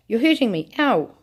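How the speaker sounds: pained cry. Acted. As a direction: yelp as the brute twists her arm. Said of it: "cried Grandma Georgina".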